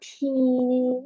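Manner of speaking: ফিনিন stretched long, with an aspirated first sound; demonstrated pronunciation. Shown as a demonstration. Teacher shows student how it is read, demonstrating a long ফিনিন. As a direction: draw out both vowels of ফিনিন after a puffed "ph". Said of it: "এরকম করে বলে আরকি".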